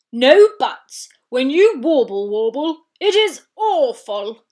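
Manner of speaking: loud, booming scolding; angry walrus. Acted. Biggest walrus scolds Warble loudly. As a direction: tell her off hard.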